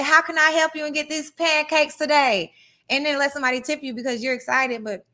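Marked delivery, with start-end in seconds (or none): 0.0-2.5 put-on sugary Southern server accent, pitched higher. Host mocks a server working customers for tips, Southern accent. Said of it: "turn on this Southern accent and say".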